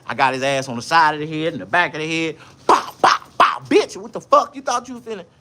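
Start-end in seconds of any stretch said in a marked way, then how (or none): 2.7-5.2 loud, higher shouting as the punches land; reliving the fight with a loud mock yell. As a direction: yell it like you're swinging at him. Acting out swinging at the man.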